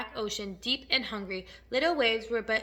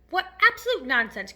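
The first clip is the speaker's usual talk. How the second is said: loud, higher dismissal; scornful cry; 4 semitones higher, 7 dB louder than usual. A narrator's reading. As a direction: cry out in exasperation. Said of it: "cried the earthworm".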